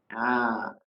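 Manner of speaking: ordinary talk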